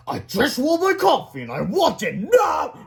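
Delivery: high, loud, drawn-out angry demand; foul-tempered demand. A narrator's reading. Principal demanding coffee from the secretary, angry. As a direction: lean on it like a furious boss. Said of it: "in a particularly foul mood".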